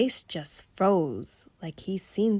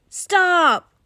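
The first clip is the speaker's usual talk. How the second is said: much louder, higher desperate stop; crying out stop; 11 semitones higher, 11 dB louder than usual. Acted. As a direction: shout to stop mom. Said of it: "I cried".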